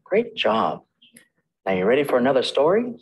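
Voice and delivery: usual speaking voice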